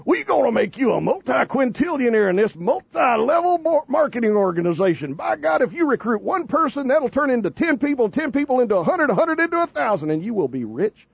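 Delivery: hammed-up multi-level marketing pitch, riding high then dropping at the end; convention talk. Host mocking a marketing meeting, high-pitched convention hype. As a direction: preach the get-rich scheme like a convention hype man. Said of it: "they were all in convention talk going".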